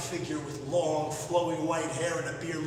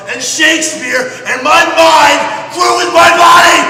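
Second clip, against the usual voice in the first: much louder and higher, proclaiming; triumphant shout; 9 semitones higher, 19 dB louder than usual. Acted. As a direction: declaim it like a victory. Triumphant climax of the story.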